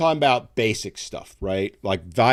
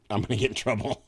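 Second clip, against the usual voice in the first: words tipping into a real giggle; laughing; 3 semitones higher, faster than usual. Real, not acted. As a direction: crack up at your own near-slip. Cracks up after holding back comment.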